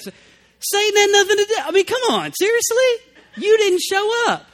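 high, loud, incredulous "come on, seriously?"; exasperated outburst. Real, not acted. Preacher to congregation, mocking a lame excuse, exasperated. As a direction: throw it back at them, fed up and disbelieving.

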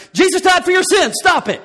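hammed-up yell at the child; mock yelling. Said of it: "I'm not yelling at her".

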